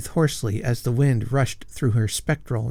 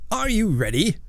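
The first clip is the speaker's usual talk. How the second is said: higher, sneering challenge; customary sneer; 10 semitones higher than usual. A narrator's reading. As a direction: sneer at the rival as a formality. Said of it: "with his customary sneer".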